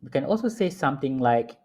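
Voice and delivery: ordinary delivery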